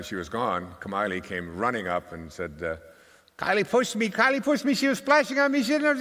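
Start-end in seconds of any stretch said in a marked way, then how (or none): 3.4-6.0 put-on high, loud, tattling little girl voice; upset little girl. Grandpa imitating a little girl complaining about her sister, high upset tattling. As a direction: squeal it out like a wronged six-year-old. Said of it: "she's getting all upset".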